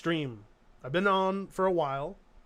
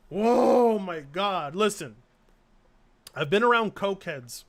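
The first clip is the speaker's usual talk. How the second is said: usual speaking voice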